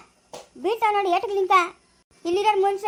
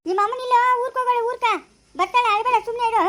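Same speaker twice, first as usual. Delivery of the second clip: exasperated coaxing; 5 semitones higher than usual. Acted. Pleading with crying baby.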